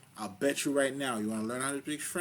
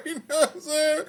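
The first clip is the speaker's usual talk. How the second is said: high laughing question; 13 dB louder than usual. Real, not acted. Laughs after making his point.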